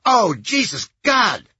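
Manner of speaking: loud, high nasal panic; startled nasal yelp at gunpoint; terrified high-pitched man. A narrator's reading. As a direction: cry out in sudden fright. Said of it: "High-pitched, nasal voice".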